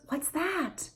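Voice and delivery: high soft questions; high hushed parentese questions; soft playful questions. Shown as a demonstration. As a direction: ask the toddler gently. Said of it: "identification of those".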